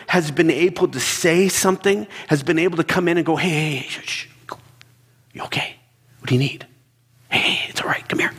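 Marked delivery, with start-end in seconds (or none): 3.4-8.3 soothing hush. Acted. Soothing someone in panic.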